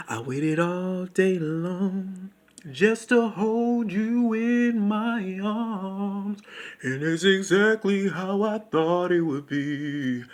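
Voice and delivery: slow, high crooning love talk; mock love serenade. Speaking to the sandwich, a slow mock love croon. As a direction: woo the sandwich like a lover.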